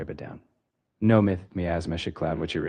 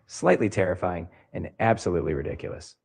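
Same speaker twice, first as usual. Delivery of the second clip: usual speaking voice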